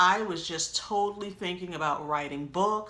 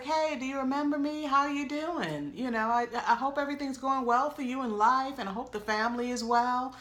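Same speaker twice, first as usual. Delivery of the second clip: higher-pitched chatty small talk; mock-friendly greeting in a higher voice; imitated friendly caller; 7 semitones higher than usual. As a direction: imitate husband's polite hello, pitched up. Acts husband's first friendly call.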